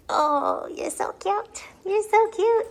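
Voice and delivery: high cooing. Real, not acted. Owner gushing over cute puppies in high baby talk.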